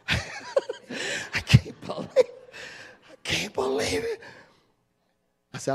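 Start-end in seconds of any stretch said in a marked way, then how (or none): 0.1-4.2 high, slow laugh near tears; laughing, crying. Real, not acted. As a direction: laugh until it almost breaks.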